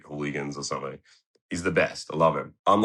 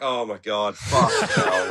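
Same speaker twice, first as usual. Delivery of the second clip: laughing exasperation; 3 semitones higher, 4 dB louder, slower than usual. Retold or imitated. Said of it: "I'm like".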